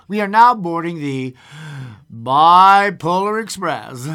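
train conductor's announcement. Pretend. Radio host boarding listeners onto the train, big announcement. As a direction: announce the train, drawing out each word.

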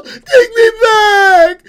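piercing, loud, drawn-out wail; pleading cry. Acted. Girl begs creature to turn back, drawn-out shriek. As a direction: shriek the plea slowly, hammed up. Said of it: "cried the little girl".

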